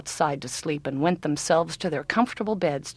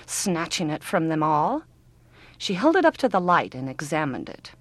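normal talking voice

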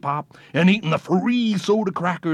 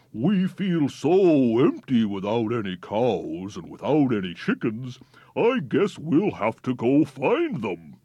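lonely barns; 5 semitones lower than usual. A narrator's reading.

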